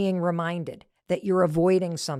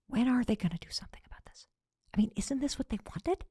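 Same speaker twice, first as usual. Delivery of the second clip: hushed, half-whispered exasperation; frustrated whisper; 4 semitones higher, 9 dB quieter, faster than usual. Acted. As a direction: whisper it like a frustrated thought kept private. Voicing silent frustration about them.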